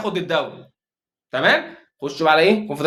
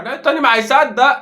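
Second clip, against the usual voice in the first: louder and higher, fed up; exasperated outburst; 7 semitones higher, 4 dB louder than usual. Real, not acted. Fan ranting at his defender, loud exasperated outburst. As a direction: throw the line out in despair.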